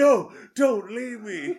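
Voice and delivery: mock begging friends to stay; slow mock plea. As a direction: stretch out the plea. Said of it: "And you're like".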